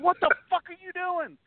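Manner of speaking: high-pitched panicked outburst at a friend's bad joke; retold freak-out. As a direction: replay the moment you nearly lost it. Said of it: "I'm freaking out at this point".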